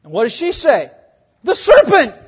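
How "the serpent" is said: high, loud sudden blurt; comic shout. Retold or imitated. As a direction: blurt it out, pointing the blame. Eve blaming serpent, comic.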